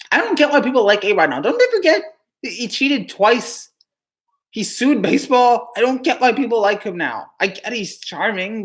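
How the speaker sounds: ordinary delivery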